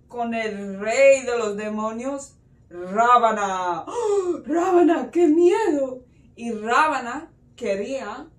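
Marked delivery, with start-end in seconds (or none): 2.7-3.8 low, loud, drawn-out announcement; ominous name reveal. Acted. Storyteller reveals the demon king to children, ominous. 3.9-6.0 loud, high gasp of dread; mock fright. Storyteller reacts to the demon king, playfully frightened.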